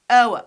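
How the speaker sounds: "O" loud, ending in a short schwa; triphthong pronunciation. Shown as a demonstration. Teacher explaining the end with schwa, "O" as "oh-uh". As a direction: say "O" as "oh-uh" in one glide. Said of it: "We pronounce it as".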